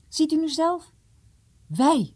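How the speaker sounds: mildly keen, pushing to be chosen; eager. Acted. Jom offers himself for the quest, mildly eager. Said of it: "zei Jom met geestdrift".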